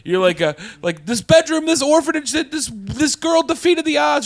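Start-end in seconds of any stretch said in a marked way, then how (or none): 1.1-4.2 lightly voiced starry-eyed guest, higher; a hint of a gushing fan, higher and louder; mock earnest guest. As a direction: gush a little, half-hearted. Imagined thoughtful guest's answer.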